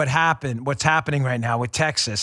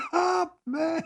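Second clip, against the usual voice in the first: laughing echo; 11 semitones higher, 4 dB quieter than usual. Real, not acted. Echoes co-host while laughing.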